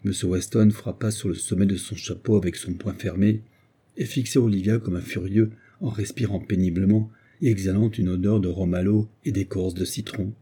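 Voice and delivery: usual speaking voice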